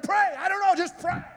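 exasperated dad telling his son to just pray; exasperated snap. Retold or imitated. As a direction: shut the kid up fast.

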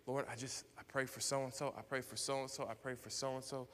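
hushed list of intercessions; murmured prayer. Retold or imitated. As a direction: pray it quietly, as if privately to God. Re-enacting his prayer of forgiveness.

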